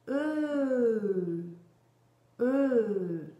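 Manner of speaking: "E" as French eu, held long and falling; sustained vowel. Shown as a demonstration. Teacher pairing the E with its hand gesture, sustained vowel. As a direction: draw out each "E" on one breath. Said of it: "pour le E, le geste de Borel".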